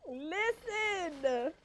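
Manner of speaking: Listen squeezed out high through laughter; can barely get the word out for laughing; laughing tearfully. Real, not acted. Vlogger cracking up at her own story, teary laugh. Laughing too hard to talk.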